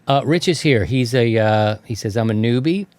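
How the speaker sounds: ordinary talk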